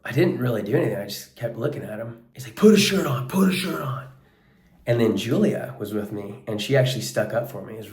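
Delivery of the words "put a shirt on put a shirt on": high, loud repeated mock yell; retold yelling. Gym guy ordering the shirtless coach, loud high yell. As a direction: hammer the order twice like an irritated gym guy. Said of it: "yelling at me".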